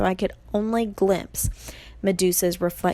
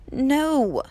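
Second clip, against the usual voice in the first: usual speaking voice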